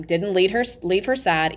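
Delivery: usual speaking voice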